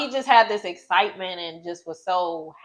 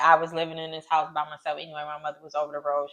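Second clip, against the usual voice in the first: ordinary delivery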